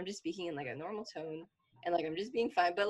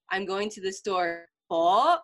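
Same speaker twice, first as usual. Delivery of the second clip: "po" hit hard and rising, as a challenge; mom's pointed correction; 5 dB louder, slower than usual. Retold or imitated. Mom correcting a child's missing honorific, pointed prompting. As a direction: echo it slowly, then a loud questioning "po?". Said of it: "then she would be like".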